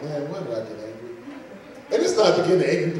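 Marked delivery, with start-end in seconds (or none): 0.0-0.9 low, soft self-reproach; retold regret. Preacher to himself after getting angry, retold regret. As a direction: mumble it low to yourself.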